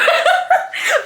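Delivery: squealing laugh; high burst of laughter. Real, not acted. Laughing about being geeked.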